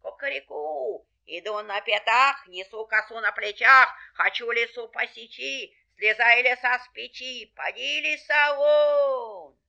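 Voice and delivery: chanted threat, building to a shout; crow, then drive the rhyme to a shout; crowing rooster. Acted. Rooster orders the fox out, commanding.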